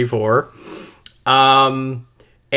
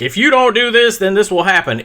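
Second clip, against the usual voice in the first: higher, pointed threat in a put-on voice; mock stern ultimatum; 7 semitones higher than usual. Mimicking a real threat to Israel, mock stern. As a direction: deliver it like a firm ultimatum.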